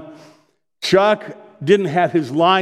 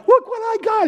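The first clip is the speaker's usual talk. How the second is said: put-on 1 proud child. Believer proudly shows the Lord.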